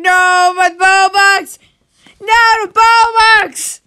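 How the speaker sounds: loud, high cry for the Robux; desperate shout. Real, not acted. Gamer yelling at the screen, losing his Robux, loud and high. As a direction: yell after the Robux as it slips away. Chasing lost Robux in game.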